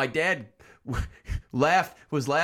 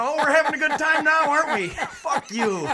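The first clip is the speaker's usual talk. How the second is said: mock the kid while laughing at him; taunting dad; 11 semitones higher than usual.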